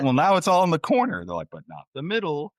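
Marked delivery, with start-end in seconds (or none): 0.0-1.3 loud, very high-pitched incredulous retort; mock exasperated parent. Acted parent arguing back. 1.5-2.5 put-on high-pitched protesting child; mock kid's comeback.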